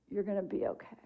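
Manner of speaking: softly voiced, tender retold comfort; soft reassurance. Friend looks over to comfort the widow, softly reassuring.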